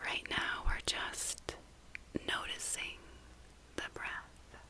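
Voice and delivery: fully whispered, with gaps between phrases; whispered meditation. Real, not acted. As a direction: whisper it gently into the listener's ear.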